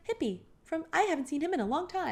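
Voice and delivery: a high, awkward imitation of the mother; high mock mom voice. Retelling the mom's awkward line.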